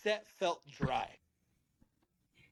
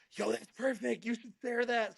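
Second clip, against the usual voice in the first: higher-pitched imitation of an excited friend; retold enthusiastic approval; 7 semitones higher than usual. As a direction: lightly act out a friend's delighted go-ahead.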